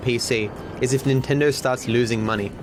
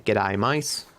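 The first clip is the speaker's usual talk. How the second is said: put-on jokey Australian accent, lightly done; a hint of an Australian accent; Aussie accent; 3 semitones lower than usual. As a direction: toss off a playful Australian greeting. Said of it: "I am Aussie".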